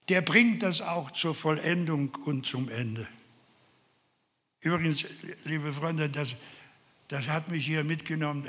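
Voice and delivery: ordinary delivery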